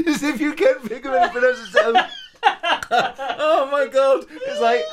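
talking high and loud through helpless laughter; laughing hard. Real, not acted. Host cracking up at his friend's awkward silence, laughing. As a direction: laugh the words out, barely holding it together.

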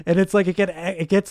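ordinary talk

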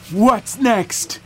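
put-on high eager old man. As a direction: ask it like a giddy old man. Gerald asks in old voice.

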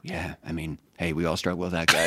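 low, hushed understanding; intense youth pastor. Retold or imitated. Youth pastor reading teens' confessions, low and hushed. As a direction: soften right down, grave and confiding. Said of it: "he started so intense".

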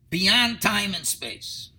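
ordinary talk

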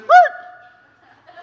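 very high, loud yelp; startled dog noise. Retold or imitated. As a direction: imitate a dog stopped short, baffled. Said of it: "That dog stops and".

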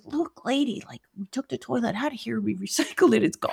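soft, flat dismissal; deadpan quiet imitation; lightly voiced blunt contractor; matter-of-fact contractor. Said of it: "the guy says".

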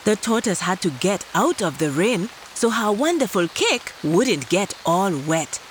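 ordinary delivery, nothing marked

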